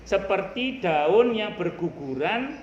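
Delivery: ordinary talk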